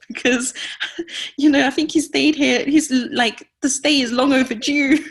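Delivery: high, loud laughing voice; words bubbling up with amusement; talking through laughter. Real, not acted. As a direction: crack up at your own joke while talking. Joking about depression overstaying its visit.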